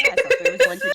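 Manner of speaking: laughing. Real, not acted. Player laughing while complaining.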